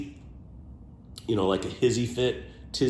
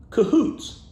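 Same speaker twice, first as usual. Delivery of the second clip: Cahoots sung out high and loud with a rise; 13 semitones higher, 6 dB louder than usual. Real, not acted. Sharing an old word, playful high delight.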